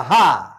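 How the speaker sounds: loud demo laugh; two big ha has, full of air; hearty ha ha. Shown as a demonstration. Lecturer leading the crowd in a loud laughing exercise. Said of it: "따라합니다".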